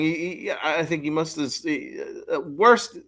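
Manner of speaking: normal talking voice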